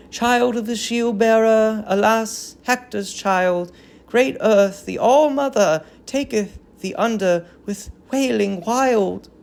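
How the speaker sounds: a touch of keening women; mourning chorus. Acted. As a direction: grieve aloud for the prince. Chorus wails for Hector's child.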